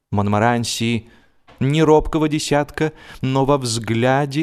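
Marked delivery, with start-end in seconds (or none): none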